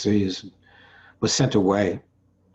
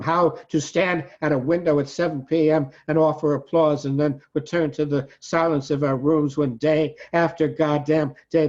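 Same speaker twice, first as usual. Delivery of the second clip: higher, insistent recitation driving through long phrases; impassioned poetry reading; 7 semitones higher, 5 dB louder than usual. Acted. Poet asks how to stand and applaud, impassioned recitation. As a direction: build the poem into an indignant outcry. Poem builds to an outcry.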